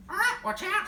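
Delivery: high, loud squawking bird; squawking parrot. Acted. Parrot squawking a warning to the chimpanzee. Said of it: "squawked the parrot".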